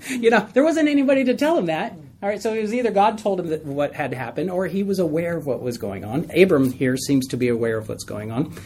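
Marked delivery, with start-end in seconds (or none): none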